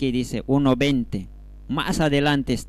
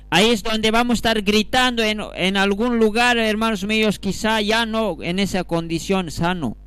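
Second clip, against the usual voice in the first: raised, high-pitched pulpit cry; fervent raised preaching voice; urgent warning; 9 semitones higher, 4 dB louder than usual. Real, not acted. Preacher warns of crying out later.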